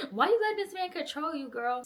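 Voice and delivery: high, quick burst of mock outrage; exasperated scolding. Scolding the heroine through laughter.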